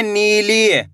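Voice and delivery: नीली with both ee sounds stretched; नीली, held long for learners; drawn-out long vowels. Shown as a demonstration. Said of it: "दोनों अक्षर में बड़ी ई की मात्रा है".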